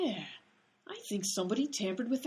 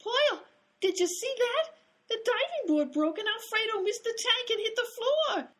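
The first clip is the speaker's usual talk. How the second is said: high, loud, excited outburst; shocked exclamation; 12 semitones higher, 4 dB louder than usual. Acted. Spectator telling a friend about the fall, high and shocked. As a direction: cry out in alarm, pitched way up.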